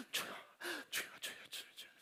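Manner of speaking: 숨소리로 속삭이듯 주여를 되뇌기; 긴장해서 옆에서 작게 웅얼웅얼; whispered murmur. Retold or imitated. Congregation murmuring tense prayers. (긴장한 성도들이 옆에서 속삭이는 기도, 숨소리로)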